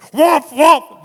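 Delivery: high, loud playful "womp, womp"; mock whomping noise. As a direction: belt out the whack sounds in fun.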